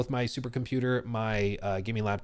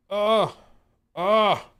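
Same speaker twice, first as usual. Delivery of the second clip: usual speaking voice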